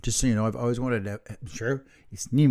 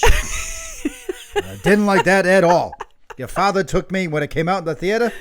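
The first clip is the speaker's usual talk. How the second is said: louder, higher New York mother impression; 5 semitones higher, 6 dB louder than usual. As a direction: kvetch in a hammed-up New York accent.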